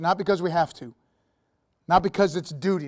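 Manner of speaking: ordinary talk, nothing marked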